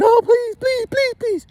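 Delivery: very high squealed pleading; hammed-up frantic begging in falsetto; mock begging. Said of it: "And they're begging".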